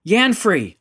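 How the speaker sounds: loud, high shout of a name; urgent call. A narrator's reading. Rendra calls for Yanfrey after the blast, urgent shout. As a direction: call out sharply for someone.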